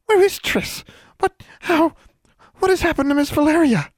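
very high, loud frantic elf voice; a tiny creature in a panic; panicked house elf. A narrator's reading. Elf asking Alexandra what happened, very high and frantic.

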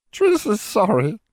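very high, slow elf voice, mumbled; a tiny elf mumbling through sobs; mumbling house elf. A narrator's reading. Elf mumbling sorry while choking back sobs. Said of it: "Triss mumbled".

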